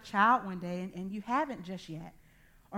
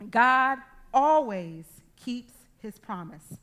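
slow, louder, each word weighed; slow emphatic preaching; 2 semitones higher, 8 dB louder, slower than usual. Real, not acted. Preacher assuring the congregation, slow and emphatic. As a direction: drive the truth home to the congregation.

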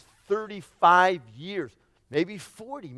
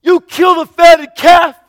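even higher, louder, slow outraged question; rising incredulous anger; 11 semitones higher, 11 dB louder, slower than usual. Acted. Older son repeating his outrage at the feast, louder. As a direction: push the disbelief further. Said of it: "just angry".

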